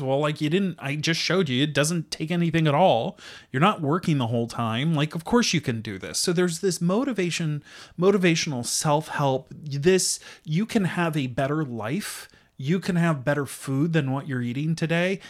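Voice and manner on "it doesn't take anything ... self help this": ordinary talk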